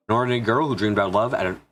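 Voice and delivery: usual speaking voice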